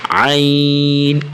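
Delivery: long throaty عین; drawn-out ain. Shown as a demonstration. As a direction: stretch the عین slowly. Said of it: "عین کو تھوڑا سا کھینچ کے".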